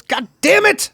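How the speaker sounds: high, sudden mock cry of frustration; frustrated outburst. Widower cursing after she praises the other guy, mock frustrated outburst. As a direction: bark it out high in defeat. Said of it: "And he goes".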